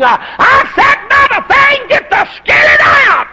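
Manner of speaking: very high, loud shout; shouting back to his wife, elated; joyful holler. Retold or imitated. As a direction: yell back in triumph.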